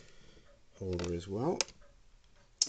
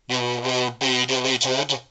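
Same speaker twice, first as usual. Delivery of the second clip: slow, loud robotic Cyberman monotone; Cyberman threat; 3 semitones higher, 9 dB louder, slower than usual. Shown as a demonstration. Hobbyist demoing the Cyberman voice to viewers, slow robotic threat. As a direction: intone it like a cold cyborg. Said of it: "give it a bit of a blast".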